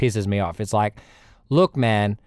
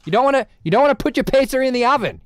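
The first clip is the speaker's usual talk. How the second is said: high, fast, exasperated telling-off; exasperated objection; 12 semitones higher, faster than usual. Acted. Streamer scolding his own oven plan, exasperated. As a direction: scold the idea like it's obvious nonsense. Arguing with himself about the oven.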